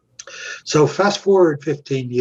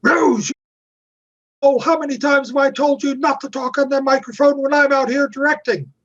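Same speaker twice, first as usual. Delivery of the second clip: high-pitched retold tirade at a crew member; mock scream, pitched way up; screaming director; 12 semitones higher than usual. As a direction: imitate an exasperated director yelling. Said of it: "he screams out".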